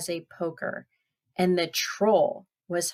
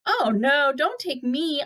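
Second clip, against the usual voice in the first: high-pitched mock alarm; pleading goat; 7 semitones higher, 4 dB louder than usual. Second goat begging the troll to spare him, high-pitched. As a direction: beg in a raised goat voice.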